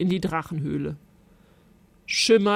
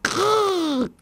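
little dragon growl; 11 semitones higher than usual. A narrator's reading. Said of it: "knurrte er".

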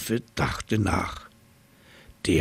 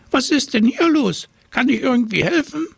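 very high, fast squeaky mouse; 4 dB louder, faster than usual. A narrator's reading. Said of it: "Sie fiepte".

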